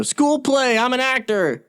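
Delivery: high, gushing mock voice; mocking eager amateur. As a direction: parody a giddy school-play actor. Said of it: "I'm not somebody that's like".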